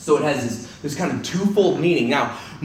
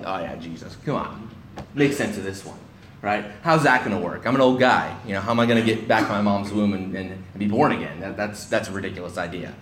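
low, softer mock sarcasm; sarcastic scoffing; 5 semitones lower, 4 dB quieter than usual. Nicodemus mocking Jesus's claim, low sarcastic scoff. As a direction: roll your eyes at the idea. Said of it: "Probably a little bit of sarcasm in here".

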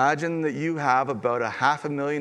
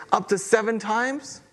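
eager rising question; 6 semitones higher than usual. Retold or imitated. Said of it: "I'm giving you the big number here".

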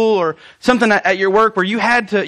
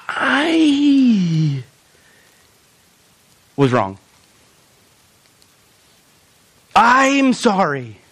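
sincere apology; much slower than usual. Shown as a demonstration. Pastor showing how to apologize to another person, slow and sincere. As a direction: say it slowly and mean it. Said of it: "and say these words".

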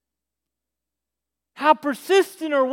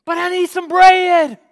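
loud, higher-pitched pleading holler; insistent calling; 4 semitones higher, 6 dB louder than usual. Acted. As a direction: keep pestering at full voice. Caller insists from the street.

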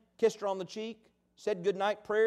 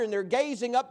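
ordinary talk

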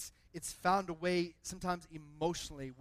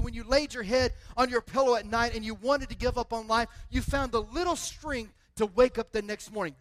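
rising, louder preaching; preaching at full force; 4 semitones higher, 6 dB louder than usual. Acted. Pastor preaching to the church about Jesus, louder. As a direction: build the congregation up.

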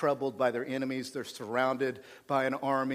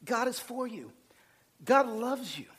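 voicing Peter; 6 semitones higher than usual. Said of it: "Peter's like".

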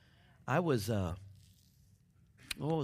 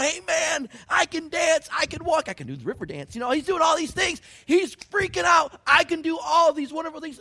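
excited shout; 13 dB louder than usual. Retold or imitated. Healed man dancing in the temple.

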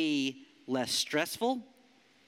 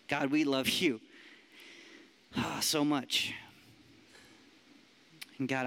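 prayer; 5 semitones lower than usual. Acted. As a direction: pray gently and sincerely. Leads a prayer.

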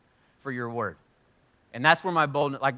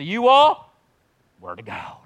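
Pentecostal voice; 8 dB louder, slower than usual. Acted. Pastor assigning a section, lightly in Pentecostal preacher style. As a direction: slip into a revival preacher's intonation. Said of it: "in the Pentecostal voice".

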